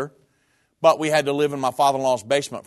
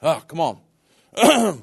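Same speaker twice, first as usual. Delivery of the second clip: slow, choked-up plea through tears; tearful; 4 dB louder, much slower than usual. Real, not acted. Pastor breaks down in his sermon story, really crying. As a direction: pull yourself together, barely holding it. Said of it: "I'm gonna start crying".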